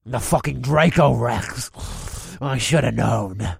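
lightly voiced orc, slow at end; a hint of a gruff orc. Same orc continues.

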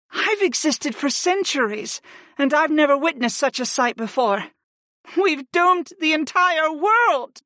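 very high-pitched, doom-laden woman's voice; dismayed Archon. Acted. Ancient Archon tells Griff of doom, dismayed. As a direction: lament high, slowing down for the final verdict.

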